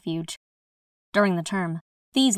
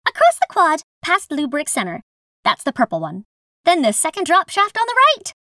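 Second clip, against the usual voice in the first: chirpy guide voice; 8 semitones higher, 5 dB louder than usual. Acted. Guide gives directions in character.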